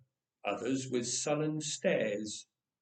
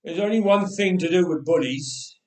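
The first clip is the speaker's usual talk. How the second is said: father's verdict; 2 semitones higher, 7 dB louder than usual. Acted. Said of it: "his father finally said".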